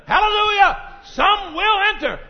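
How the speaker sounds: high, loud praise shout; joyful shout. Real, not acted. Preacher rejoicing before the church that some will enter. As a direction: lift it up in joy.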